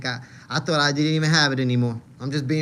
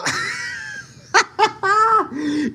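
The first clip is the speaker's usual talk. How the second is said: high, loud burst of laughter; laughing hard; 5 dB louder than usual. Real, not acted. Streamer cracks up at a viewer's comment, high loud laugh. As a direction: crack up helplessly, high-pitched.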